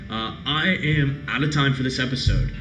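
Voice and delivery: ordinary delivery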